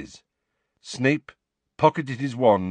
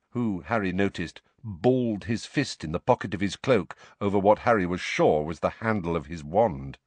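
ordinary delivery, nothing marked

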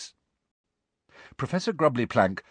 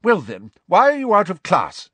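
high, loud demand; stern professor; 9 semitones higher, 7 dB louder than usual. A narrator's reading. Professor questioning pupil out of class, sternly. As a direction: demand an explanation loudly.

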